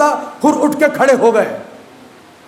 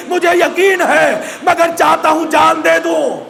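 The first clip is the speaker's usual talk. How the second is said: preaching at full force; 4 semitones higher than usual. Acted. Rising mourning recitation.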